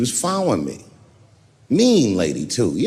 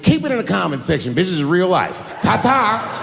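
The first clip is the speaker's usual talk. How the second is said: loud, raised comeback with a high sign-off; triumphant retort; 6 semitones higher, 6 dB louder than usual. Retold or imitated. Comic dismissing his critic before driving off, mock triumphant retort. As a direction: retell a dismissive kiss-off, pitched up. Acted parting retort to the woman.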